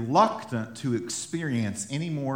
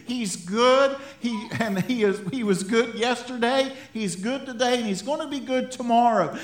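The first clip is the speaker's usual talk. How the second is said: higher, louder, fervent exhortation; preaching at full force; 8 semitones higher, 4 dB louder than usual. Acted. Pastor rallying his congregation, preaching with full force. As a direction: drive it home to the church.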